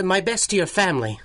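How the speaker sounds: ordinary talk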